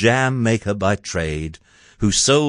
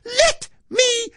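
very high, drawn-out screech; Cindy's high, stretched demand to be released; shout; slower than usual. A narrator's reading. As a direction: shriek each word slowly. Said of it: "As Cindy shouted".

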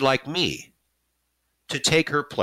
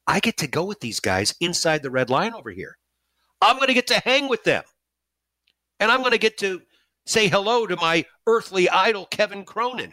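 giddy fan thoughts; 8 semitones higher than usual. Retold or imitated. Excited fan's inner thoughts.

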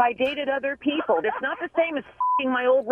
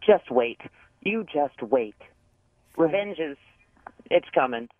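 very low, halting warning; menacing threat; 13 semitones lower than usual. Real, not acted. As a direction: threaten revenge in a low voice with pauses. Wronged woman threatens revenge.

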